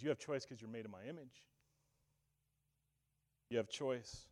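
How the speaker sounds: soft, low, hushed answer; God's quiet reply. Pretend. Preacher voicing God reassuring humanity, soft and low. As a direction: let God speak it gently and low. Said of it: "God says".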